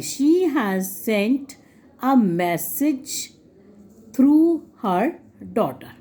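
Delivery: slowly, spacing each word out; slow dictation. Shown as a demonstration. Teacher slowing down so students can write the answer. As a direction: spell the answer out at dictation pace. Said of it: "मैं इसलिए slowly बोल रही हूँ".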